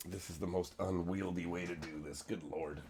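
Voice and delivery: very quiet grumbling aside; muttering to himself. Real, not acted. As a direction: mutter it under breath.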